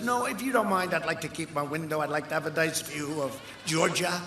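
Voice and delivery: lightly voiced fussy homeowner; mock homeowner. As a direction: play a timid owner pleading to keep his window. Voicing a protesting homeowner.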